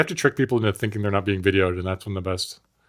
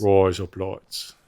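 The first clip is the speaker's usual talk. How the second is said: Australian accent; 3 semitones lower, much slower than usual. Shown as a demonstration. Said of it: "razor blades in Australian".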